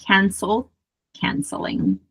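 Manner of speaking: ordinary talk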